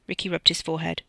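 ordinary delivery

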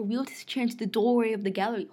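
plain reading voice

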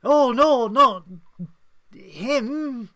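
high, very loud hammed-up wail of grief; mock melodramatic despair. Mocking the show's grief over an unknown character, hammed-up wail. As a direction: overplay the show's tragic gasp, mocking it. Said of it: "they build it up to say".

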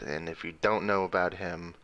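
normal talking voice

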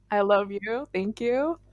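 ordinary talk, nothing marked

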